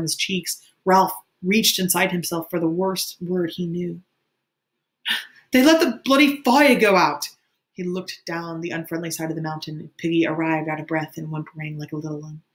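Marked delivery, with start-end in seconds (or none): 5.5-7.3 high, loud furious shout; enraged high-pitched yell; bitter outburst. A narrator's reading. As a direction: spit out the accusation bitterly, loudly. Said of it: "the bitterness of his voice".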